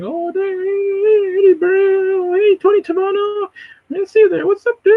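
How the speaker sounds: squealing, very high excited impression; Vocab, high pitch. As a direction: greet viewers in a hammed-up sky-high voice. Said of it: "He gets excited".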